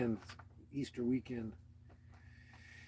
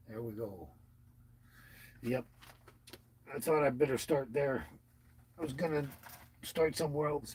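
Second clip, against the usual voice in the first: usual speaking voice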